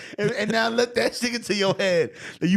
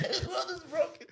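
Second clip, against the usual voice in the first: much softer, higher, through laughter; laughing repeat; 10 semitones higher, 9 dB quieter than usual. Real, not acted. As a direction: say it again breathless with laughter.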